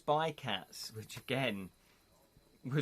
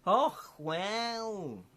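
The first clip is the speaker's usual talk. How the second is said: put-on Welsh accent; 8 semitones higher than usual. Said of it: "Sorry about my Welsh accent there".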